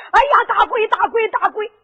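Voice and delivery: loud, panicked yelling of ghost; frightened old woman shouting ghost; terrified cry. Acted. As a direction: scream it as you crawl away. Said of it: "爬着还喊着".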